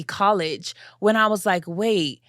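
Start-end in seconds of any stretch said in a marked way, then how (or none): none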